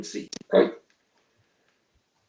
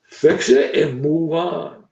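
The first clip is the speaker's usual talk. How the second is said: slow, louder and deliberate; slow emphatic repeat; 5 dB louder, slower than usual. Shown as a demonstration. Coach drilling a rule into fellow coaches, slow and emphatic. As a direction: spell it out slowly and firmly.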